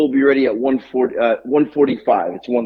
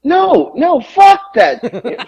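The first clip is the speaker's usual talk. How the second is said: indignant outburst; 12 semitones higher, much slower than usual. Real, not acted. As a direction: cut in, refusing to let it go. Refuses to drop the story.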